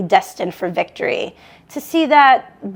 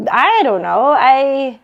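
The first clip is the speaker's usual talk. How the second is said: louder, halting, unsure start that breaks off; Joan hesitating; 3 semitones higher, 5 dB louder, slower than usual. Acted. Joan unsure on the phone to her sister, halting. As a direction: stumble over the doubt.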